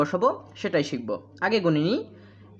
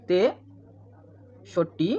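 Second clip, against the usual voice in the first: তেষট্টি drawn out in two parts, long gap after তে; split into syllables; much slower than usual. Shown as a demonstration. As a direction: break তেষট্টি very slowly after its first syllable. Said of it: "তেষট্টিটা হাজার উচ্চারণ হবে".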